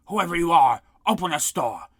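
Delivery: bang-on-the-door shout. A narrator's reading. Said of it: "shouted Scrank".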